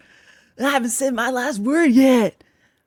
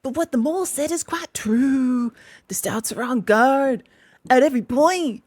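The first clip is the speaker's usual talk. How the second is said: kindly Badger; 3 semitones higher than usual. Badger consoling crying Toad, gently. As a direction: comfort him, a touch gentler. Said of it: "went on the badger more kindly".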